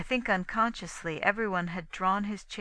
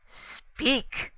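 higher, restrained menacing command; menacing cry; 8 semitones higher, 3 dB louder than usual. A narrator's reading. Poirot standing over Inglethorpe, mildly menacing. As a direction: order it with a touch of threat. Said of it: "he cried menacingly".

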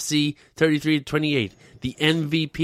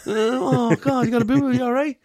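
much higher, cooing; mock baby talk; 9 semitones higher, 4 dB louder than usual. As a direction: coo as to a toddler.

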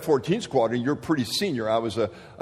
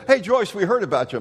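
hearty general; 8 semitones higher, 6 dB louder than usual. Retold or imitated. General greets new officer.

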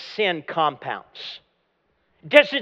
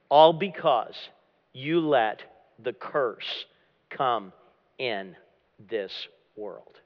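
slow, sinking lower and fading softer; grave pronouncement; 7 semitones lower, 5 dB quieter, slower than usual. Acted. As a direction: weigh each word, letting it drop away to near quiet. God pronouncing curse, quoted.

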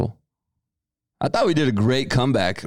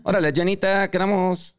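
put-on playful Naco Mexican accent, pitched higher; 8 semitones higher than usual. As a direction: ham up the Naco Mexican street voice. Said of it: "That's the Naco voice".

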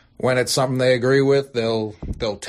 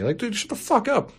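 exasperated retort; 12 semitones higher than usual. Real, not acted. Rant at critical fans.

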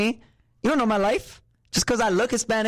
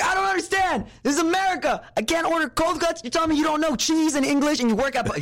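high shouted rant as dad; imitating angry dad; 12 semitones higher than usual. Son imitates his dad ranting about the deli counter, a high shouted rant. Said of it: "came back and was so angry".

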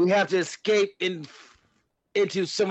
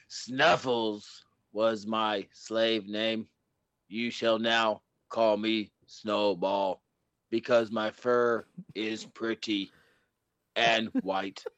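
grind it out like a cyborg demanding answers; robot voice; 7 semitones lower, 3 dB quieter than usual. Acted. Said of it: "I had the robot voice".

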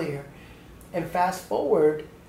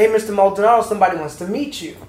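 loud, higher call across the room; 4 semitones higher, 9 dB louder, faster than usual. Retold or imitated. Principal calls the teacher over to meet Lisa, loud call. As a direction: call him over so he hears you over the kids.

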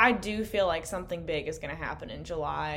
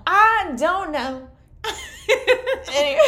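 slow, high, louder, dissolving into laughter; laughing; 11 dB louder than usual. Real, not acted. Caught rambling by her friend, laughing helplessly. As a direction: give up mid-thought and crack up.

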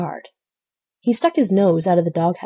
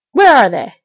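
loud, raised call; shouted question; 5 semitones higher, 8 dB louder than usual. A narrator's reading. Farmer at the window asks after thieves, shouting. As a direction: call it out urgently from afar.

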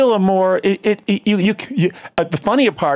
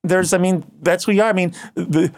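ordinary delivery

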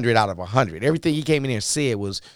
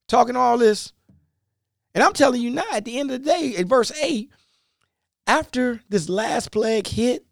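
angry Moses; 10 semitones higher than usual. Retold or imitated. Moses scolding Pharaoh for his threats, angry and high. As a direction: confront him hot with anger. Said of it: "Moses then got angry".

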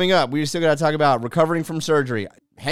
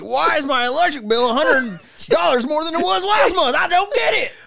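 very high-pitched mock whining; whiny complainer; 14 semitones higher, 4 dB louder than usual. Host mimicking social media complainers, high mock whine. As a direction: squeal it like a baffled griper.

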